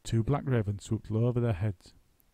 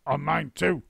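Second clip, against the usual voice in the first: shouted; 5 semitones higher, 4 dB louder than usual. A narrator's reading. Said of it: "shouted Mister Twit".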